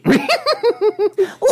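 very high put-on snickering laugh; cartoon dog snicker. Scooby's cartoon laugh in the bit.

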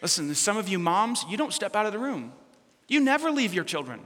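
ordinary talk

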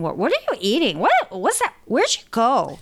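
put-on high, curious kid voice with a big jump up; a nosy kid firing off questions; little boy voice. Owner voicing her cat Monty, high and curious. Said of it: "that's Monty's, like, voice".

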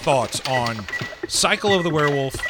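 loud, punchy host announcer delivery; podcast sign-off. Acted. Host asks listeners to write in, in an announcer's sign-off. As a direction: project it with emphatic pauses.

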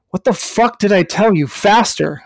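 mock exasperated demand; imitated scolding. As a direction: scold your pacer, in imitation. Runner scolding her pacer, retold.

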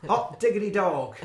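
high, loud hammed-up yeehaw; southern accent. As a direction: whoop it like a cartoon cowboy. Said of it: "That's enough butchery".

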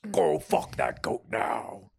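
low, slow order; deep, deliberate command. A narrator's reading. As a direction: command it slowly, word by word. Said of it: "she commanded".

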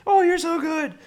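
exaggerated baby-talk praise; gushing praise. Shown as a demonstration. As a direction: gush at the dog like it won a prize. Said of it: "It doesn't have to be".

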